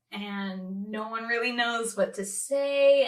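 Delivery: usual speaking voice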